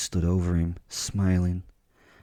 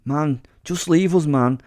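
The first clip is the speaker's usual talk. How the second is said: put-on higher-pitched boy begging in street slang; pleading street boy; 7 semitones higher than usual. Boy offering surrender to his attacker, pleading. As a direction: plead to be left alone, with a stammer. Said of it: "Such a butchery of our beautiful mother tongue".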